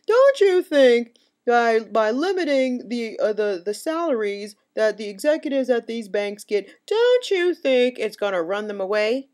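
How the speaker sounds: a sneering impression of the interviewer; mocking David Gregory. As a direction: parody the host's question, dragging it out. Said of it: "David Gregory was like".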